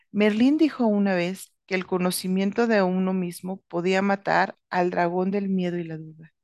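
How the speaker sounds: plain reading voice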